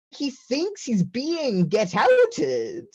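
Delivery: high, slow disbelief; exasperated. Retold or imitated. Explaining to mom the boy's theory, high exasperated. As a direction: spell out the absurdity.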